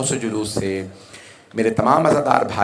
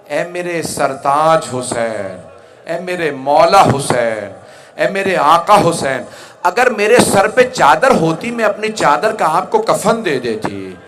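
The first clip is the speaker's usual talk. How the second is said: imitated widow's lament, building higher and louder; tearful lament; 6 semitones higher, 5 dB louder, slower than usual. Widow addressing her slain husband, lament rising in force. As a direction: begin drawn-out, then swell into impassioned grief. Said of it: "जनाब रबाब ने रो के फरमाया".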